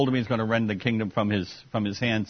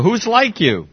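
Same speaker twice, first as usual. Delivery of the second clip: much higher, louder mock question; sarcastic jab, pitched up; 9 semitones higher, 5 dB louder, slower than usual. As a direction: needle him with fake admiration. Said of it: "the sarcasm here".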